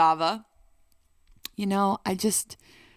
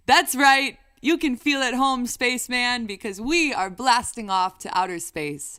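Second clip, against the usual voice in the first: loud, higher hype; excited; 4 semitones higher, 6 dB louder than usual. Real, not acted. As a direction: pump it up. Hyped song promo.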